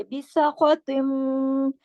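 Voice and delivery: बिसखतिम very slow, mim held with a nasal hum; recitation with ghunna. Shown as a demonstration. Teacher demonstrating idgham to students, slow chant. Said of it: "अकमल गुन्ना भी होगा".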